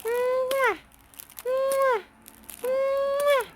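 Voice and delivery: long high "mwah" falling off; playful kissy sounds. Real, not acted. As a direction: hum up into a big squeaky kiss. Kissy noises for baby.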